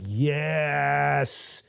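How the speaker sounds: long drawn-out, satisfied yes; pleased, drawn-out reaction; happy approval. Retold or imitated. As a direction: stretch out a gratified YES. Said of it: "that's what made me happy".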